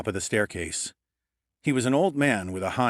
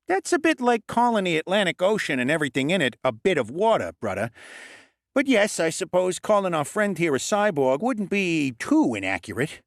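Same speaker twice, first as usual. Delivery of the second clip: higher, brisk lecturing goblin voice; know-it-all goblin; 9 semitones higher than usual. A narrator's reading. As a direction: explain like a smug little expert. Lennox replies.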